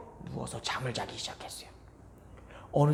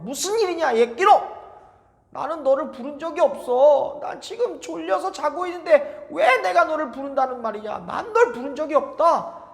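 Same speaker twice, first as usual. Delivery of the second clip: put-on higher, louder cross old man voice; grumpy old priest; 9 semitones higher, 7 dB louder than usual. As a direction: scold the boy for waking him. 졸린 엘리 제사장. (잠 깬 엘리 제사장의 짜증 섞인 목소리)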